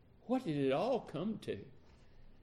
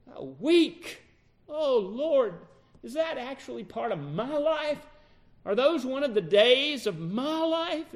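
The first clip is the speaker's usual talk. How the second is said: higher, straining lament to God; anguished remorse; 8 semitones higher, 3 dB louder than usual. Acted. Peter imagined pleading with God in his shame, anguished. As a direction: cry out to the Lord in shame.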